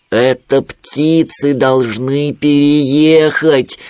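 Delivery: slow, drawn-out triumphant realisation; crying out. A narrator's reading. Winnie finally hitting on a plan, crying out. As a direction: proclaim the idea, stretching the words. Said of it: "наконец вскричал медвежонок".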